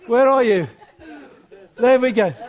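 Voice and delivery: ordinary talk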